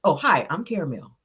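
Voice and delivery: ordinary talk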